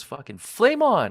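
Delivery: high, loud, drawn-out shout of the catchphrase; hammed-up battle cry. Retelling the hero's twist line, a high loud shout. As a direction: bellow it like a superhero cry. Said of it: "and he goes".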